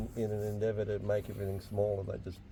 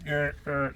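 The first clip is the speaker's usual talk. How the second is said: higher-pitched mock gear crunch, twice; imitated gear grinding; 6 semitones higher than usual. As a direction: imitate gears grinding with your voice. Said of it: "someone grinding gears".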